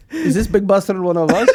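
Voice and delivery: high, loud character voice through laughter; hammed-up question, breaking into laughs; laughing mock suspicion. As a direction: size up a newcomer while cracking up. Acts scouting men, laughing.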